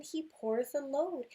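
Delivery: plain reading voice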